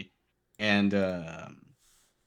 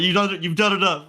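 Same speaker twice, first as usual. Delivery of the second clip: high, louder retold rebuff; exasperated refusal; 7 semitones higher, 5 dB louder than usual. Shot player to the kid offering help, exasperated refusal. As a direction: re-enact exasperation at a would-be helper.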